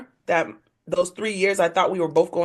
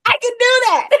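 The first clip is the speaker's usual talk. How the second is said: very high, gleeful squeal of defiance; triumphant exclamation; 4 dB louder, much faster than usual. Retold or imitated. Woman free to have a beer now, triumphant exclamation. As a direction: burst out high, relishing the freedom. Relishing freedom from that family.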